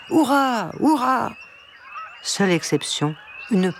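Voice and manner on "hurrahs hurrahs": high-pitched drawn cheers of hurrah; cheering. A narrator's reading. Gathered birds acclaiming the duck, cheering. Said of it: "les oiseaux poussèrent des hurrahs".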